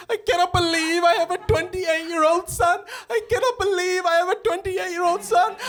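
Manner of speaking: very high, loud, overwrought mother voice; mock a mum crumpling into an emotional heap; hammed-up tearful disbelief, shrieked high. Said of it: "She'll crumple into, like, an emotional heap".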